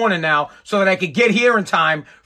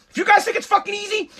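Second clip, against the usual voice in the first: much higher, shouting in frustration; real shout; 11 semitones higher, faster than usual. Real, not acted. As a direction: yell it at the listeners. Venting at his listeners.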